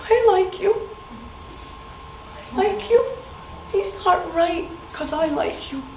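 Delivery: put-on a hurt boy comforting the one who hit him, earnest; imitated little boy. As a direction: sweetly reassure like a small boy.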